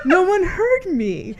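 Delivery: high, slow indignant retort; retold indignant self-defense; defensive protest. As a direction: draw it out, pleading your case. Retold defensive argument with friend.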